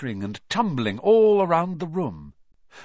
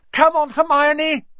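loud high shout upward; 10 dB louder than usual. Acted. Boy shouting up the trapdoor to Hermione, loud high shout. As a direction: yell for her to jump.

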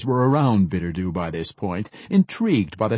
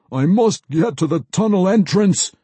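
firm, lightly raised mage voice; steady yourself and say it like a man; a hint of steadied mage; 7 semitones higher, 6 dB louder than usual. A narrator's reading. The mage firmly tells the dwarves where he must go. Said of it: "without a howl, yip, or yelp".

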